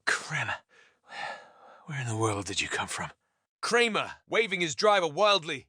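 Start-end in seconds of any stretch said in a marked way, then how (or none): 0.0-3.1 low, soft, winded voice; winded, hushed question; catching his breath. A narrator's reading. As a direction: pause for breath, then rush the question. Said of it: "catching his breath".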